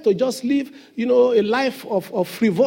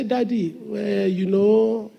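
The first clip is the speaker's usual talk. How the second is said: slow, soft and tender plea to a father; unhurried, tender childlike ask; loving ask; 4 dB quieter, slower than usual. Shown as a demonstration. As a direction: coax it gently, like a child asking Daddy. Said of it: "ask God rudely, lovingly".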